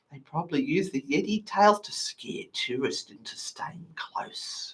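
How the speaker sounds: secretive stage whisper. Acted. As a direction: lean in and whisper it. Conspiratorial aside in lecture.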